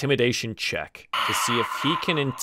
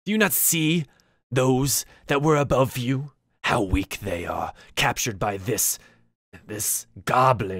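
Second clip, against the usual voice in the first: slow, lightly voiced scorn; scornful shaman; slower than usual. Shaman belittles the goblin captors to the orc, slow mild scorn.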